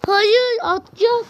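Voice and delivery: panicked cry. Real, not acted. Game moment panic.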